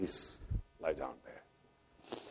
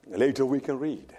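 ordinary talk

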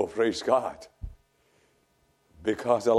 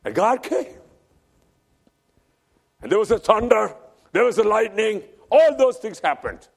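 raised, ringing preacher's voice; preaching at full force; 10 semitones higher, 7 dB louder than usual. Acted. Preacher retelling Elijah's flight to the congregation, preaching at full force. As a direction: proclaim it to the congregation. Thunder and lightning scene preached.

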